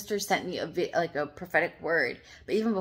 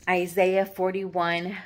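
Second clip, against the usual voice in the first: ordinary talk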